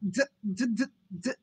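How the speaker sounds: stuck on the first consonant; stuttering attempt. A narrator's reading. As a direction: stammer on D without reaching the word. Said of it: "Simon attempted the sentence again".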